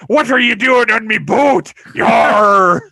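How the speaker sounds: loud, high pirate-y sailor voice; gruff old sea captain. Retold or imitated. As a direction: bark it like a salty old captain. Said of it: "he's like".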